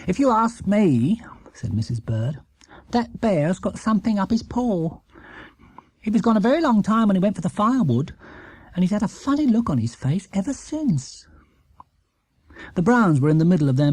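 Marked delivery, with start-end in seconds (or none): 0.1-11.3 Mrs Bird, knowing tone. Acted. Mrs Bird voiced in story.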